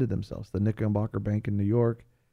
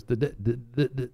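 mock stutter. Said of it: "he didn't stutter".

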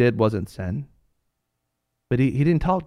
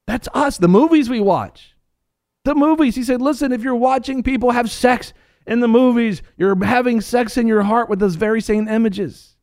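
fiery preaching; 7 semitones higher than usual. Acted. Preacher rebuking the church, in fiery high preaching. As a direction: hammer each point at the congregation.

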